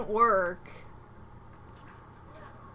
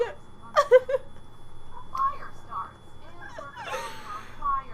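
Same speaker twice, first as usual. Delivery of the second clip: whispery high wheeze; wheezing laugh. Real, not acted. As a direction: laugh without voice. Keeps laughing at mishap.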